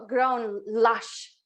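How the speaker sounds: ordinary talk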